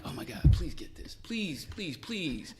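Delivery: urgent whispered prayer; pleading whisper. Retold or imitated. Officer willing lieutenant to understand, pleading whisper. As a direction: beg under your breath, drawing out each please.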